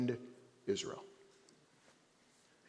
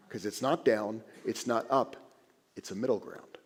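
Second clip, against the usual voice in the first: usual speaking voice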